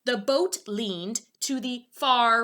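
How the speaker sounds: ordinary delivery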